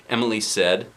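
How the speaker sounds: usual speaking voice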